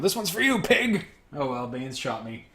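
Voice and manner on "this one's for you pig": high, louder villain yell; mock yell. Baines shooting the officer, high mock yell. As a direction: yell it out as a taunt. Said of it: "you hear Baines yell".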